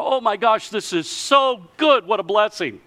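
high, gushing cry of delight; excited townspeople. Retold or imitated.

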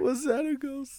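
put-on high, drawn-out little-girl question; mock little girl. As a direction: play innocent in a high, slow kid voice. Re-enacts the little girl's excuse.

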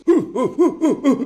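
high hooting imitated with a laugh; laughing mock hoo hoo noises. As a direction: ape the actor's odd little hoots. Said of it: "he kinda goes".

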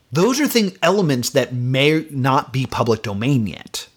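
ordinary talk

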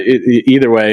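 usual speaking voice

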